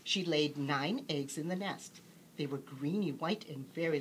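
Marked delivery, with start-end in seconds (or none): none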